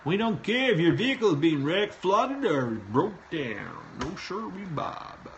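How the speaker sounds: high, loud mock-Swedish sing-song, slowing at the end; exaggerated lilting Swedish accent; put-on Swedish accent. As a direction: swoop through it in comic Swedish. Repeats the announced mock accent.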